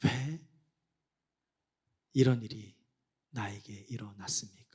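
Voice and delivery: low, halting plea fading toward a whisper; hushed lament. Acted. Wounded believer asking God why, hushed lament. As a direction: ask it brokenly, with long pauses. Acting a sufferer's question to God.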